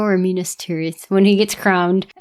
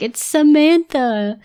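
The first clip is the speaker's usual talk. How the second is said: mock heartbreak; 7 semitones higher, slower than usual. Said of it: "'cause it's like".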